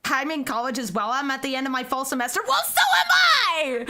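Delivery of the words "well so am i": high, laughing outburst; much higher, giddy cry of kinship; gleeful exclamation. Real, not acted. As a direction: crow it with delighted laughter. Mock celebration with chat.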